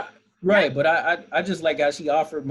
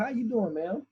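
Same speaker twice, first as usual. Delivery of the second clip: higher, friendly manager greeting; cheery manager; 7 semitones higher, 4 dB quieter than usual. Retold or imitated. Restaurant manager greets the grandmother, lightly voiced higher. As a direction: greet her like a polite manager. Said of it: "the manager comes over and says".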